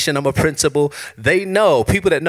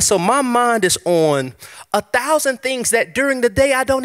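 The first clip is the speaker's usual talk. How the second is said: preaching; 6 semitones higher than usual. Acted. Pastor urging the congregation, full preaching. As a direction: preach it out to the room.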